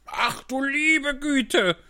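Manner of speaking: high, slow, shrill-pitched cry of dismay; shrieking king. Acted. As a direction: squeal it out like a panicked old king. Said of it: "schrie der König".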